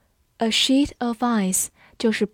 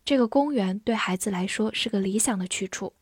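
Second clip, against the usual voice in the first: normal talking voice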